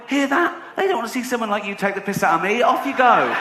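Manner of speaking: high, mocking taunt over the boos; gloating taunt. Comedian jeers the heckler as the crowd boos, gloating. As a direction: rub it in, grinning, sending him off.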